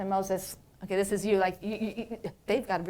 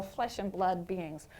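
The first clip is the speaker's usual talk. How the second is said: ordinary talk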